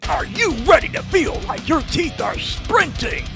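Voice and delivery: hyped ad announcer. Acted. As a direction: yell it like an extreme sports ad. Energy drink ad.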